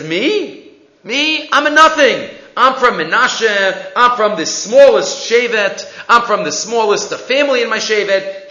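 high, disbelieving Gidon, slowing down; can't believe it's him; astonished humble protest. Acted. Humble Gidon protests his unworthiness.

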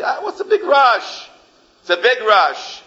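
high, slow scoffing drawl of a shirker; mocking whine. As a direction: stretch it out, brushing off any urgency. Procrastinator mocks urgency.

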